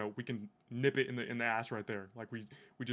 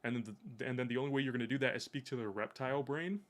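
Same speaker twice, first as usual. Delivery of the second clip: ordinary talk, nothing marked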